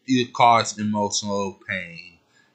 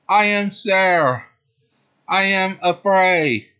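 scared heroine; 8 semitones higher, 3 dB louder than usual. Acted. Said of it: "She spoke again".

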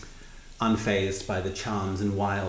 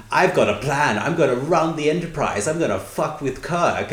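higher, louder mock scheming; loud retold boasting; 6 semitones higher, 5 dB louder than usual. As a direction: announce the plan far too loudly. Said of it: "loudly, in front of two security personnel".

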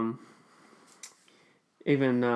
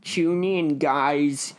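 mock show host; 3 semitones higher, 4 dB louder, much slower than usual. Announcing a theory segment to listeners, mock-host hype. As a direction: call it out like a hyped host.

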